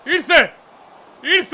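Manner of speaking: high-pitched exclamation, twice; excited cry. Real, not acted. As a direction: cry out as the predicted goal lands. Predicted goal arrives. (τσιριχτό επιφώνημα ενθουσιασμού)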